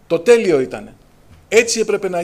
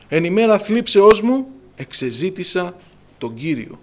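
slow, louder opening, sinking to the end; slow psalm recitation; 4 dB louder, slower than usual. Acted. Preacher reciting a psalm verse to the congregation, slow and solemn. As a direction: intone the verse slowly like scripture. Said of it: "Τι λέξη που χρησιμοποιεί".

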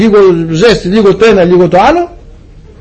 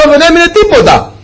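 excited; 12 semitones higher than usual. Acted. Fire from heaven story climax.

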